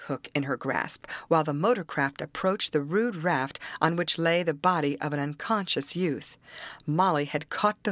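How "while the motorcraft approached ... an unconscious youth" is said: usual speaking voice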